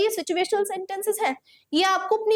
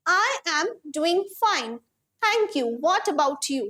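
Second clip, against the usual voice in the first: slow model sentence; much slower than usual. Shown as a demonstration. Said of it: "model sentence read slowly".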